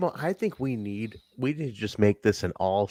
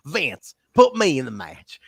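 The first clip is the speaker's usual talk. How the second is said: higher-pitched mock Southern accent; A J, super Southern; 4 semitones higher, 4 dB louder than usual. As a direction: imitate a super Southern wrestler making a demand. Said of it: "He's super Southern".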